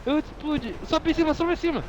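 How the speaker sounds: high frantic urging; panicking gamer. Real, not acted. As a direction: scramble in a panic. Frantic gameplay.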